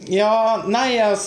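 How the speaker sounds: high, hesitant stalling; awkward backpedalling. Acted. Sister cornered by her brother's question, hedging.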